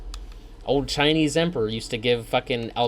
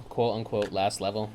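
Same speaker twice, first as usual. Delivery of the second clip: quote-unquote last level, softer and lower with irony; air-quoted; 4 semitones lower, 7 dB quieter than usual. Shown as a demonstration. Gamer doubting the game's quote-unquote last level, softer. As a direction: wink at "last level" as not really last.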